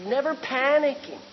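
normal talking voice

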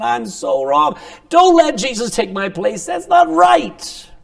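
pleading, rising to a high drawn-out cry on the last words. Retold or imitated. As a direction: beg as a broken man crying out to God. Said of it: "crying out".

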